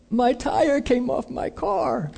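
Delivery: high, slow, thin mock crying; tearful imitation. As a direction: wail it softly, pitched right up. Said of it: "He's kinda crying".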